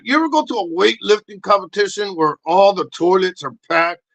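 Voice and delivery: ordinary delivery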